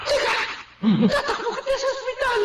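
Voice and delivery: high frantic cry; panicked. Acted. Wife panicking as husband collapses, frantic cry. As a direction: blurt it out in panic.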